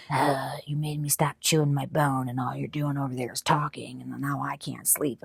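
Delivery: a sulky Frenchie grumbling under his breath; grumpy dog. Retold or imitated. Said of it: "a snore sigh where he's like".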